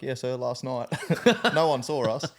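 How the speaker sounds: can't hold the laugh in. Real, not acted. Telling the officer about the nudie run, laughing.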